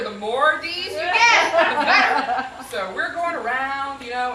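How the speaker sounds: ordinary talk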